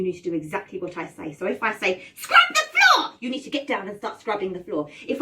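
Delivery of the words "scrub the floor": very high, loud witch command; bossy witch. Shown as a demonstration. Showing the game's orders, shrieking bossy witch. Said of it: "I'm the witch".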